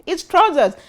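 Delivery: retelling the shock of being told to say pants; surprised protest. Retold or imitated. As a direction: act out your disbelief at being corrected. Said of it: "I was like".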